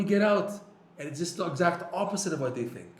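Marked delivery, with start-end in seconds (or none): 0.0-0.5 raised, louder acted rejection; imagined dismissal. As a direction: voice the feared order to leave, higher and louder. Imagined tzaddik throwing him out.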